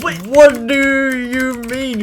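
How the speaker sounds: high, slow puzzled question; confused. Real, not acted. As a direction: stretch it out in mock confusion. Confused by friend's comment.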